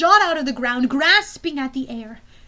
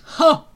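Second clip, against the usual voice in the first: scornful huff; 4 semitones higher, 11 dB louder than usual. Acted. General scoffs.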